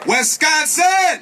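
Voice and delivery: loud, slow, high rally call; hollering the state name; long drawn-out shout. Real, not acted. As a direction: stretch it out and belt it across the crowd. Leader calls out state, drawn out.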